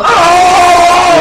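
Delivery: high, loud mock war cry; mock battle cry. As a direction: yell it like charging in. Imitating Connor attacking.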